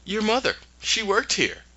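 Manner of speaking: lightly voiced cruel reveal; mock witch taunt. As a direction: tease her like a gloating madam. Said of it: "It's like".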